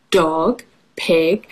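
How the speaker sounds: ordinary talk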